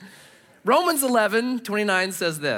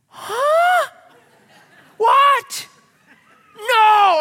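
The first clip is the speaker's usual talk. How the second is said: much higher and louder, laughing shout; outraged disbelief; 9 dB louder, much slower than usual. Retold or imitated. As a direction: yelp in comic outrage. Sees Grateful Dead sticker.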